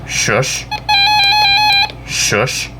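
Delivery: ordinary talk, nothing marked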